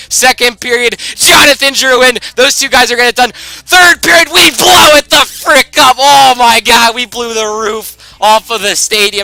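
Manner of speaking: building to a loud, high yell of triumph; excited shouting. Real, not acted. Gamer watching his team blow out the rival, triumphant shouting. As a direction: scream it as the goals pour in. Huge third period comeback.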